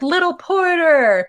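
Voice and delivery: high, slow drawn-out call across the hall; older kids calling out. Retold or imitated. Senior students calling to the little brother. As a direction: sing out the nickname like teasing seniors. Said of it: "the calls of".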